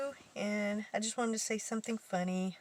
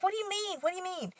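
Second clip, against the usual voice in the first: much higher, repeated pushy question; mock indignant; 11 semitones higher, faster than usual. Teller fakes offence at coworker's verdict, much higher demanding voice. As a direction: demand an answer, feigning offence.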